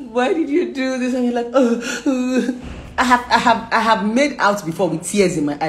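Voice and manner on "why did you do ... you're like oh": high, tearful whimpering; mock crying. Couple crying together during reconciliation, mock tearful. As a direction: act out sobbing through the words. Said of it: "the both of you be crying".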